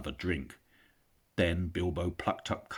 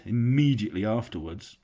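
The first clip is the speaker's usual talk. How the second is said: ordinary delivery, nothing marked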